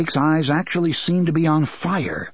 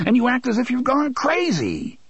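shouted accusation; 12 semitones higher than usual. Acted. Father accusing his son, exasperated. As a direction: hurl it at him.

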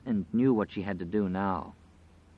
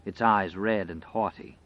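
usual speaking voice

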